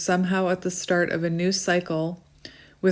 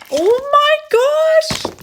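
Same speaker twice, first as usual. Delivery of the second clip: high, slow, squealing with delight; excited squeal; 9 dB louder, much slower than usual. Real, not acted. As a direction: squeal it out in pure joy. Card she hoped for appears.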